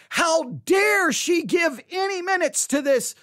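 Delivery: high, slow indignant protest; mock outrage. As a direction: imitate fired-up critics, drawing out the indignation. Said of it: "all fired up".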